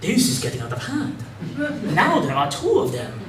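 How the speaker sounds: put-on lightly done Newt Gunray alien accent, quiet; a hint of Newt Gunray's Neimoidian accent. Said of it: "if I wanna do that accent, I have to be quoting Newt Gunray".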